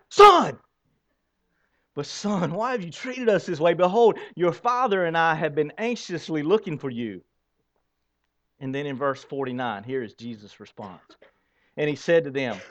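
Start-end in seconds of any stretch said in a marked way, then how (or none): 0.1-7.2 acting out a mother frantic over her lost child; upset mother. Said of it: "all the emotions of Mary when she lost his son".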